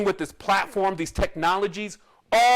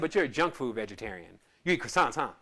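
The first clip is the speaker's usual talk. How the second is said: teasing doctor; 7 semitones lower, 5 dB quieter than usual. Retold or imitated. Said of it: "But he said".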